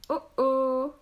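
drawn-out pondering exclamation; pensive. A narrator's reading. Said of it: "fit Domitille à songeuse".

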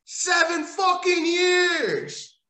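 fed-up howl; exasperated shout. Real, not acted. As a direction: stretch it out in outrage. Ranting about repeated matches.

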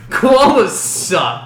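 slow, loud, drawn-out contempt; drawn-out emphatic. Acted. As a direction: stretch it out with mock disgust. Mock emphatic declaration.

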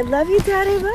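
high, loud affectionate farewell; called-out goodbye. Real, not acted. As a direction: call it back to Dad as you rush off. Hurried goodbye while running for bus.

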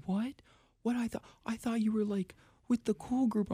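high, startled mother's voice; surprised mom. Retold or imitated. Mom hearing her kid has no friends, high surprised voice. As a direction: play the mom's shocked disbelief. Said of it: "And she was like".

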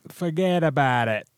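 hammy Jersey tough-guy catchphrase, slowed; mock Jersey. Cohost echoes the Jersey Shore catchphrase in a drawn-out New Jersey accent. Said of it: "a thing from Jersey Shore".

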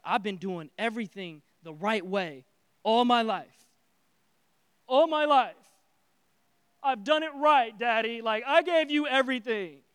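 furious. Acted. Older brother raging at his dad, loud and furious. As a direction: vent years of resentment at Daddy. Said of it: "he's furious".

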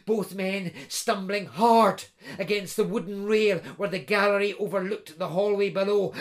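ordinary delivery

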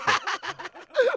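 short high laugh; a quick laugh up high; laughter. Real, not acted. Host laughing at the co-host's joke, high laugh.

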